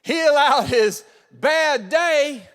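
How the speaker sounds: high, loud preaching. Acted. Pastor preaching the lesson to the church, full force. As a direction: drive the point home.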